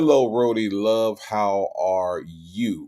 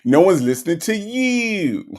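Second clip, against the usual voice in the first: high-pitched elongated imitation; Nesta; 8 semitones higher, 4 dB louder than usual. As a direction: stretch it out high, playfully mimicking. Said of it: "sound like Nesta when you say you".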